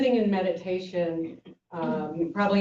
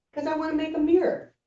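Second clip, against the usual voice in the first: teacher's reply; 10 semitones higher, faster than usual. Retold or imitated. Teacher answers monk.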